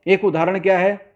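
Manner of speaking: usual speaking voice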